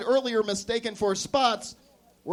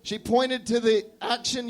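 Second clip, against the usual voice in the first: ordinary delivery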